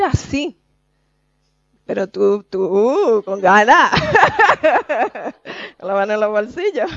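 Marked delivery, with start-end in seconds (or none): 1.9-7.0 loud laughing tease; laughing mockery. Real, not acted. Preacher laughing at someone floating with hands in pockets. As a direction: laugh at the careless one. Mocking the careless swimmer.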